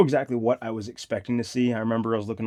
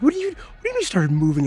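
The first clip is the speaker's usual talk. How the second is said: high, loud, fast alarmed question; alarmed disbelief; 12 semitones higher, 7 dB louder, faster than usual. Retold or imitated. To his coworker at the bar, a high fast alarmed question. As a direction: rush it out in disbelief.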